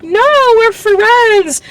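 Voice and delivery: imitating a squeaky, unconvincing denial; mock-innocent high voice; high-pitched denial. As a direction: deny it way up high, hammed-up. Retold panicked denial of dating.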